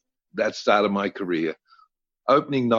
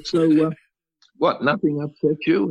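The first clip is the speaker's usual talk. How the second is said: higher, fishing question; probing; 7 semitones higher than usual. Retold or imitated. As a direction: coax her to admit it, pitched up. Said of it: "And I said".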